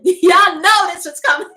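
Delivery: laughing through the words, slow; amused, slow laughing delivery. Real, not acted. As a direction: giggle it out to the viewers. Laughing opener to viewers.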